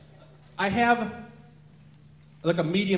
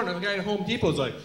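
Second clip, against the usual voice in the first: ordinary talk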